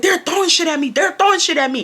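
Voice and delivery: high, insistent mock complaint; protesting student. Student telling on classmates to the teacher, high imitated protest.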